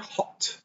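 "hot" breathed out, open O; whispered "hot". Shown as a demonstration. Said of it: "get the O of the O".

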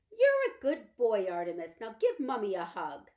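put-on fussing over her boy; doting mother. Mother praises her son.

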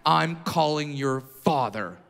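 low, slow, grave authority; stern principal. Retold or imitated. Principal scolding a kindergartner, lightly voiced stern. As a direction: lay down the threat slowly and low. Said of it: "the principal looks at me and goes".

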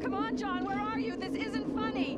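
frightened, rising calls; calling out. Pretend. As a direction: shout for them, growing panic. Calling out for missing friends.

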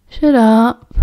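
put-on drowsy, slurred drunk reply; nodding off. A narrator's reading. Drunk Sirius answering Remus while nodding off, drowsy. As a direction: say it as if falling asleep. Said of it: "nodding off".

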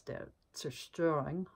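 German title in a German accent. Shown as a demonstration. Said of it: "Pardon my German accent".

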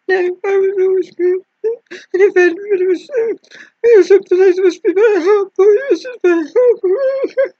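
high, whiny self-pity; mock self-pitying whine. Nurse mocking her old self, whiny and pitiful. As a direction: whimper for sympathy like a martyr. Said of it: "That's what I was like".

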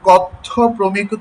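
কথ্য প্রমিত drawn out slowly, kotho with an o sound; slow, deliberate model of কথ্য প্রমিত; pronunciation demo. Shown as a demonstration. Teacher modelling the right sound for students, slow and careful. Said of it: "উচ্চারণটা কিন্তু".

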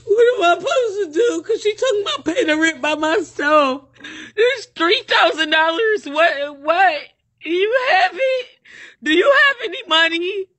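high, crying plea; tearful. Real, not acted. Asks friend for help with the rent, crying. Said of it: "I'm about to cry".

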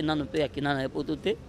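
ordinary delivery

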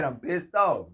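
usual speaking voice

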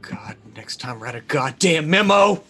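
loud exasperated yell; angry shout. Acted. Ray yelling at the creature as he flees, angry shout. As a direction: snap it out in frustration.

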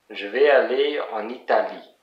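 French sentence ending on stressed "Italie"; French pronunciation. Shown as a demonstration. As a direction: model French, landing on the last syllable of "Italie". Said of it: "in French, you say Italie".

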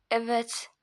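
hushed grim yes; whispered. Acted. Whispered confirmation.

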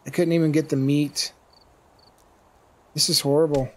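ordinary talk, nothing marked

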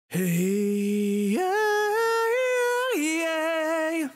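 sung with heavy reverb; drenched in plate reverb and delay; cave echo. Real, not acted. Sounds a little like I'm in a cave.

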